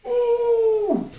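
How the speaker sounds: high thrilled whoop; excited ooh. Real, not acted. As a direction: whoop on the dive. Diving stairwell.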